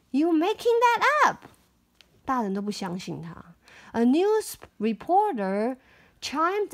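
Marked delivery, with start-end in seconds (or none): 0.1-1.5 accusing. Acted. Mayor accuses girl.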